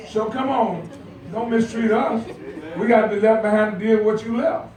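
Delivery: ordinary talk, nothing marked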